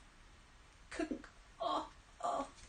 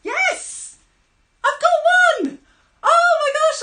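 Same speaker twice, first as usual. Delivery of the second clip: thrilled catch; 13 dB louder, slower than usual. Pretend. Pretend catching a fish.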